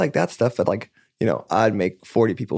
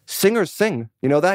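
higher, emphatic dad impression; insistent dad; 7 semitones higher than usual. Dad telling his kid singers sing, imitated insistence. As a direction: quote dad's motto with a pitched-up push. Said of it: "He's like".

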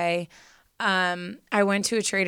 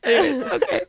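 high, teary laugh-cry; real tears breaking through the laughter; 9 semitones higher than usual. Real, not acted. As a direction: caught between laughing and crying, voice pitched up. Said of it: "I think I'm gonna cry".

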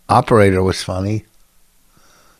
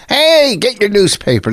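high street-vendor call; Chicago newspaper seller; 9 semitones higher than usual. Shown as a demonstration. Correcting co-host on old Chicago paper selling, newspaper seller's call. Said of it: "when they were selling papers in Chicago".

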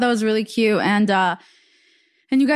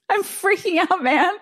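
laughing; 8 semitones higher than usual. Retold or imitated. Laughing while repeating her quote.

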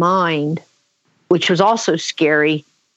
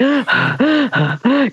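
quick, panting gasps acted out; imitated out-of-breath panting; panicked gasping; 6 semitones higher than usual. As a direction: gasp like a kid who just escaped. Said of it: "breathing, like".

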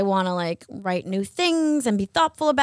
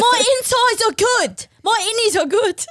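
hammed-up British voice, pitched way up; British accent; 10 semitones higher, 3 dB louder than usual. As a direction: plead in a comic British accent. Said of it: "I kept doing this British accent".

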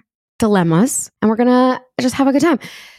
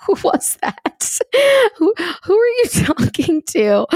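mock husband; 9 semitones higher than usual. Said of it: "my husband will be like".

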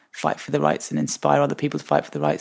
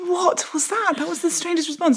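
bewildered; 13 semitones higher than usual. Retold or imitated. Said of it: "everyone just looked at me like".

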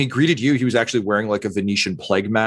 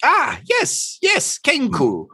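high, drawn-out avian character voice; bird doctor; much slower than usual. Acted. Bird doctor confirms his race to Tortle, high creature voice. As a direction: agree eagerly as a high-pitched bird creature.

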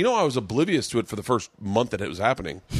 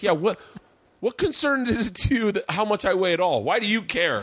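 higher, indignant mock activist; defiant activist; 8 semitones higher than usual. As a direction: push back at the critics, offended. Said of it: "things that you hear fat activists say".

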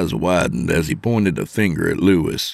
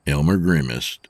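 plain reading voice

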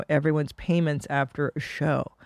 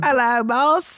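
higher-pitched Cockney greeting; Cockney accent; 5 semitones higher, slower than usual. Acted. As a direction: put on a broad Cockney. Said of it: "a Cockney British guy".

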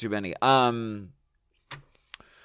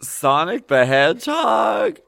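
shocked outburst; 9 semitones higher than usual. Real, not acted. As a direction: shout it in total disbelief.

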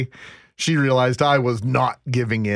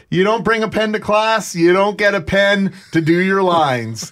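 higher, emphatic telling-off; scolding teacher; 6 semitones higher, 4 dB louder than usual. Retold or imitated. Teacher denying a pupil a pen, mock scolding. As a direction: imitate a teacher laying down the rule. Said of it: "He says".

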